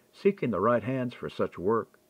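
usual speaking voice

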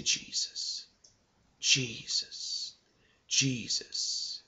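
soft breathy whisper of the name, slowly; whispered prayer. Shown as a demonstration. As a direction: whisper Jesus softly, like a prayer with eyes closed. Praying aloud, eyes closed.

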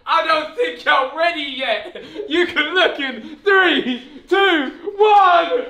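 hyped countdown. Real, not acted. Friend counting down to his outfit reveal, hyped. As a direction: build up the reveal, drawing out the numbers.

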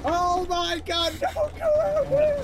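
panicked screaming. Real, not acted. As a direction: scream like something is chasing you. Panicked yelling in game.

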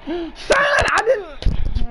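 sudden high yell with a gasp; gasping outcry at a bad play; shocked shout. Real, not acted. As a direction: yell it in disbelief at the screen. Reacting to game play, shouting.

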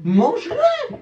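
high, shrill command; shrieking order. Real, not acted. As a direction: screech it at him. Forcing friend to eat the bean.

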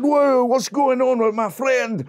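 loud, high Scottish protest; indignant Scottish outcry; alarmed Scottish bloke. Acted. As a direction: shout out in a Scottish accent. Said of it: "the other Scottish bloke said".

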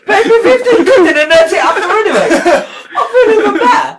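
high, loud laughing through the words; laughing hard. Real, not acted. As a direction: laugh and yelp while talking. Laughing through chili pain.

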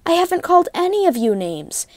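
insistent. A narrator's reading. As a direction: protest your innocence, high. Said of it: "insisted Duck".

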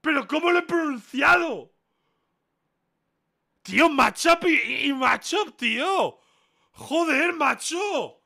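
high-pitched, loud disbelief; outraged shouting. Real, not acted. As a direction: protest at the top of your voice. Outraged at being marked wrong.

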